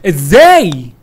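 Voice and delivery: very loud angry how; angry outburst. Real, not acted. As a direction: erupt in frustration. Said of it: "دي اول حتة عصبية تطلع مني". (انفجار غضب عالي)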